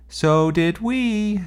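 high, loud, slow boast; shouted. A narrator's reading. Sebastian answering his sister's yell, slow proud shout. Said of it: "shouted Sebastian".

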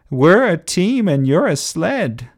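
high, loud taunting rhyme; jeering call. A narrator's reading. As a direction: throw the insult out high. Engines shout insults at Winthrop.

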